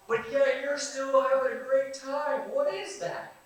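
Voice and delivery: high, loud, astonished voice of an outsider; amazed onlooker. Retold or imitated. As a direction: gush like a stunned stranger who can't believe it. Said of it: "they look at us and they go".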